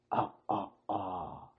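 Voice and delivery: low creepy drawn-out ah; haunted house moan; spooky moan. Pretend. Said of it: "Do I sound spooky or just lame?".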